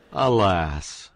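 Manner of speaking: loud, hammed-up lament; mock grand exclamation; theatrical alas. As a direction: cry it out like a stage tragedian.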